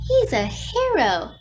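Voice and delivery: calling out. A narrator's reading. Visitor explains herself through the garage door, calling out. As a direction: call it out emphatically.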